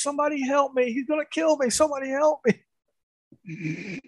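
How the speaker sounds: high, panicked cry for help; imitating a girlfriend shrieking for help; mock terrified scream. Girlfriend shrieking for help at the haunted-house guy, mock terrified. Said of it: "started screaming at the top of her lungs".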